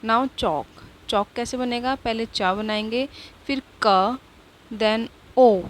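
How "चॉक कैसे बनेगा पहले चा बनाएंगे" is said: ordinary talk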